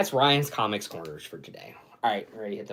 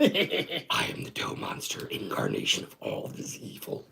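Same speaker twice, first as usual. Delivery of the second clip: slow dramatic monster voice; villain voice; 11 semitones lower than usual. Acted. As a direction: growl it like a movie monster. Mock villain quote.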